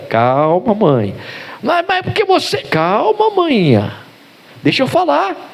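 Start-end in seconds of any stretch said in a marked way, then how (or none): 1.6-2.6 much higher, flustered cut-in; high, stammering mother; agitated mother. Acted. 2.6-5.5 much higher, coaxing plea; high, drawn-out "calma, mãinha"; pleading son. Acted. Son pleads, calming his mother.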